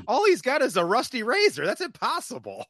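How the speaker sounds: high, incredulous protest; mock disbelief. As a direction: exclaim it in exaggerated disbelief. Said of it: "I'm like".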